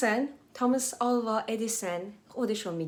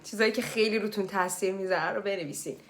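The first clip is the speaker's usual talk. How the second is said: ordinary talk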